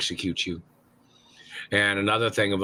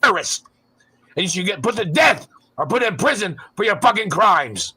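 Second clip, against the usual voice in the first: furious shout; 10 semitones higher, 6 dB louder than usual. Real, not acted. Angry rant continues.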